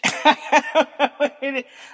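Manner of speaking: big high cackle that falls away; loud high laugh. Real, not acted. Laughing loudly at herself after calling herself a jackass. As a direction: let out a loud amused cackle. Laughing at her own joke.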